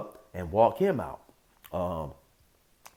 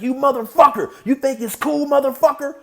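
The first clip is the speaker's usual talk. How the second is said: loud, high acted tirade; imitated angry screaming; 8 dB louder than usual. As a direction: holler at him in a rage. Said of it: "he's sitting there screaming like".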